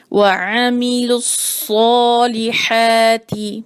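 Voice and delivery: slow melodic recitation of وَعَمِلُوا الصَّالِحَاتِ; Quran recitation. Acted. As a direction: recite it slowly, tajweed style. Recites the verse. (آہستہ تلاوت کا انداز)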